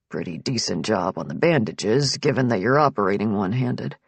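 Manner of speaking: very low man's voice, understated approval; note it casually in a deep register; Nick, appraising doctor. A narrator's reading. Nick examining her bandaged hand, low and appraising.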